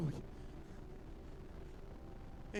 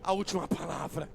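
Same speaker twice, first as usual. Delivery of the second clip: rising, forceful pulpit preaching over music; preaching at full force; 4 dB louder than usual. Acted. Preacher to the church, rousing preaching. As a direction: build the crowd up with each repetition.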